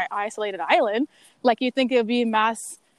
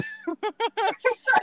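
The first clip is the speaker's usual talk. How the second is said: high, panting laugh; breathless laugh; 10 semitones higher than usual. Real, not acted. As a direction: laugh while gasping for air. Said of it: "losing her breath".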